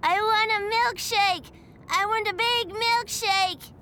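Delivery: put-on high, childish demanding shout; a whiny kid yelling for a treat; shouting little boy. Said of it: "he shouted".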